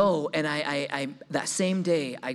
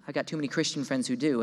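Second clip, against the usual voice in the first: usual speaking voice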